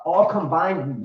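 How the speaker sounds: ordinary talk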